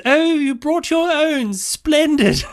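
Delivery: put-on very high, slow, plummy posh British accent. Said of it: "he had this very posh British accent".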